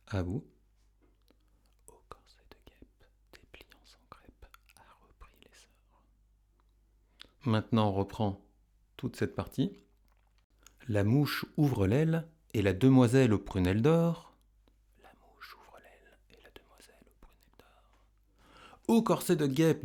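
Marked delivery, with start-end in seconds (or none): 1.9-5.7 barely audible whisper; whispered echo. Shown as a demonstration. Whispered echo in pupils' turn. 15.0-18.0 barely audible whisper; whispered echo. Shown as a demonstration. Whispered echo in pupils' turn.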